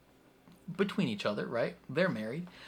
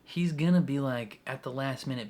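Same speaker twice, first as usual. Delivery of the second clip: normal talking voice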